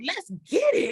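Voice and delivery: hyped shout; fired-up cheer. Retold or imitated. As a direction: call it out like you're revved up for a fight. Acting out excited practice reaction.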